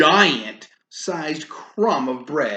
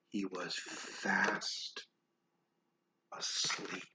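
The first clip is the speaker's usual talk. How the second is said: hushed whisper; 6 semitones lower, 16 dB quieter, slower than usual. Acted. Reading the giant falling asleep, hushed whisper. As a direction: whisper him to sleep. Bedtime hush.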